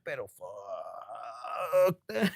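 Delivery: very drawn-out, low expletive; fed-up mock refusal. Reader voices client fed up with sibling, drawn-out refusal. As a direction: stretch the curse out long and low, playfully.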